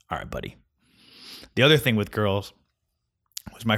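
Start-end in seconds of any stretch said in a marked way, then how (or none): 0.1-0.6 muttered aside. Real, not acted. Dismissive aside.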